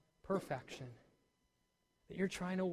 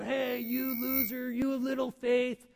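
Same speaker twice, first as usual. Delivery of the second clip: higher, slow mocking yell of an insult; 7 semitones higher, 6 dB louder, slower than usual. Preacher mocking a yelling Jesus at the disciples, hammed-up jeer. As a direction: jeer it like a harsh Jesus, half in fun. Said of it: "like, yelling this back to the disciples".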